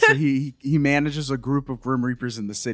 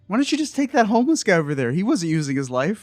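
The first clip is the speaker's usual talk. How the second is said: quick, higher-pitched mock plea; retold bargaining; 8 semitones higher than usual. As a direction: wheedle fast, imitating someone making a deal.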